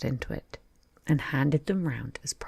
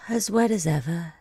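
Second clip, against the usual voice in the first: melancholy; 2 semitones higher, 3 dB louder than usual. Acted. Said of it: "said Alice in a melancholy tone".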